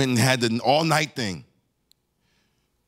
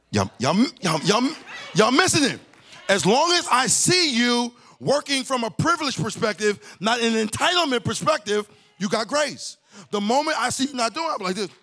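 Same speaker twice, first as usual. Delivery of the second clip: preaching fervor. Real, not acted. Preacher urging the congregation, high and fervent. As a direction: voice pitched up high, urgent and pressing.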